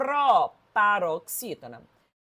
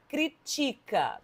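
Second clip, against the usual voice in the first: "critica" said slowly, stressed on the TI; stress moved to second syllable; 3 semitones higher, 4 dB quieter, much slower than usual. Shown as a demonstration. Teacher showing the word without its accent, "critica" stressed on TI. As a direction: say "critica" as cri-TI-ca. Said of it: "se eu tirar o acento".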